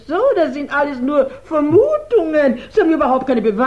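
upset protest. Acted. Accused woman protests detective.

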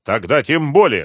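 curt, emphatic dismissal; understated icy refusal; cold. A narrator's reading. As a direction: cut them off coldly. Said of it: "холодно отрезала врач".

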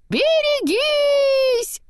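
very high, loud, drawn-out warning cry; long shout. A narrator's reading. Olaf's head flying past, very high drawn-out yell. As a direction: stretch the warning out as you fly. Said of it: "прокричала голова снеговичка".

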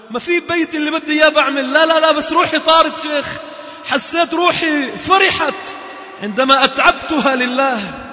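high, loud exultation, the middle shouted slowly; exultant cry. Real, not acted. Preacher to brothers on the joy of tiring for God, exultant shouting. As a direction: let the joy burst out. Joyful climax of sermon.

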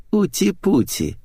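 high, slow cooing; mocking baby talk. A narrator's reading. Natasha taunts her trapped friend, mocking coo.

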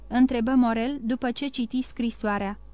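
plain reading voice

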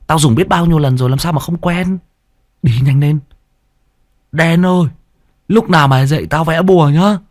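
put-on squeaky whiny boy. A narrator's reading. Sidekick complaining about chewed leaves, high whiny voice. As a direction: gripe like a spoiled kid. Tý chatters.

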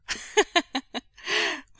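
high, loud laugh; sudden amused cackle; burst of laughter. A narrator's reading. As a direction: laugh out loud at a child's remark. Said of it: "Wei Wuxian laughed".

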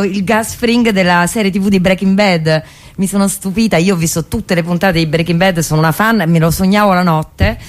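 ordinary talk

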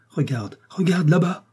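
frightened companion points out the horror, pitched high and urgent; urgent alarm. A narrator's reading.

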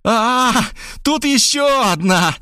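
high female cry, slow on the discovery; shrill cry. Acted. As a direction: scream out the shock of finding another woman. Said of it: "её истошный крик". (истошный высокий женский крик)

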